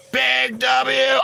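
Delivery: high shouted cheer; hype shout. Real, not acted. Streamer cheering the corgi dance, high shout. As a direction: shout it out to the chat.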